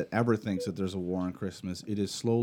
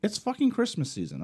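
worked up; 9 semitones higher, 3 dB louder than usual. Real, not acted. Ranting about Christmas.

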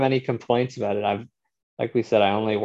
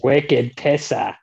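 put-on Boston accent; 4 semitones higher, 4 dB louder, much slower than usual. Boston accent joke about Departed.

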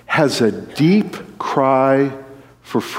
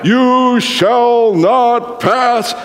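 very slow, high, booming wizard proclamation; Gandalf; 9 semitones higher, much slower than usual. Retold or imitated. Preacher re-enacting a movie scene, slow grand command. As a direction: imitate a wizard's dramatic stand, each word drawn out. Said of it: "that scene with Gandalf".

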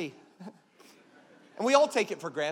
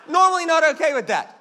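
higher, louder punchline; emphatic shout; 5 semitones higher, 9 dB louder than usual. Real, not acted. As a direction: shout it out for the laugh. Repeated line, shouted.

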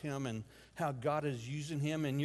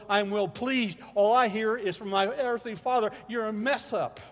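higher and louder, pouring it out; pleading outburst; 6 semitones higher, 9 dB louder than usual. Retold or imitated. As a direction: build into a desperate loud cry. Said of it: "and I'm going".

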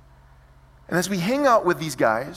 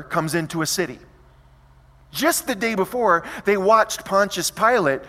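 louder preacher build-up; preaching; 4 semitones higher, 4 dB louder than usual. Acted. Preacher contrasting Pilate's entrance, rising preaching. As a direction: drive the contrast home from the pulpit.

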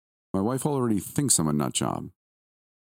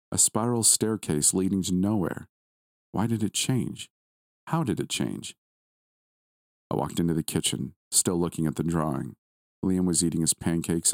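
ordinary delivery, nothing marked

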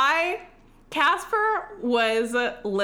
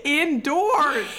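higher, indignant exclamation; mock outrage; 7 semitones higher, 3 dB louder than usual. Friend aghast at pandemic restaurant meal, mock outrage. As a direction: exclaim it high and appalled.

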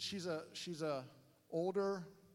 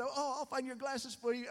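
higher-pitched, obliging tourist; lightly mock your own helpfulness; eager naive helper; 7 semitones higher than usual. Tourist searching floor for stranger's glasses, mock eager helpfulness.

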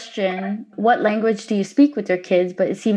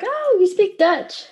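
high, slow and drawn out with a mock-naive tone; mock clueless reply; 11 semitones higher, slower than usual. Host mimicking her younger self, who mixed up Danish and Dutch, in a slow, high clueless voice. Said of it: "He's like".

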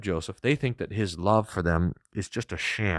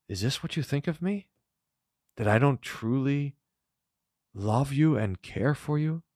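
higher, hurt and pleading, lightly voiced; a hint of a weeping brother; tearful Joseph; 7 semitones higher than usual. Joseph asking his brothers through mild tears. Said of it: "Joseph wept when they spoke to him".

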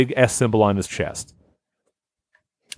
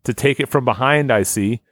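ordinary talk, nothing marked

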